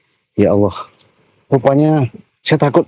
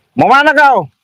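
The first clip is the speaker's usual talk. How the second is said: high-pitched challenging yell; confrontational call; 13 semitones higher, 3 dB louder than usual. Real, not acted. Driver to the man blocking him, high confrontational call.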